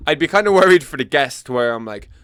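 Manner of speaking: usual speaking voice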